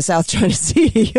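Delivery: amused, laughter spilling into the punchline; breaking into laughter. Real, not acted. Host mocking Biden's negotiations to guest, laughing. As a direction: laugh through the last words.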